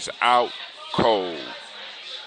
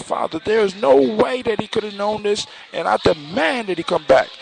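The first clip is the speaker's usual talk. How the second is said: high-pitched, insistent demand; indignant protest; 10 semitones higher than usual. A narrator's reading. Princess demanding of her father that he return, indignant. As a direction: plead your case indignantly, pitched well up.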